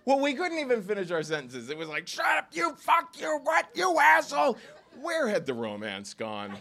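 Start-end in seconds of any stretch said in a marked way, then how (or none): 2.1-4.6 angry yelling. Retold or imitated. Shoving match in the cab.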